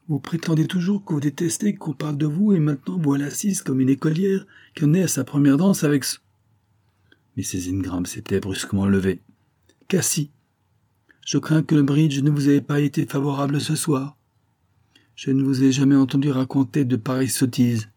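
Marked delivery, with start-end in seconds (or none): 0.1-6.2 high-pitched, mocking reproach; acted tirade. Miss Wilpert berating her companion like a schoolgirl, scornful tirade. 9.9-17.9 high-pitched, pointed reprimand; acted rebuke. Mrs Ingram cutting short her companion's nonsense, rebuking.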